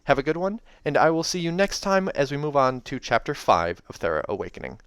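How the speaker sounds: ordinary delivery, nothing marked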